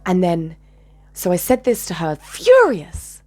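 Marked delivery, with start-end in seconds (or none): 2.1-3.2 high, loud burst on one word; furious emphasis. Retold or imitated. Speaker recalling what she said to her, furious emphasis. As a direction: punch it out with fury.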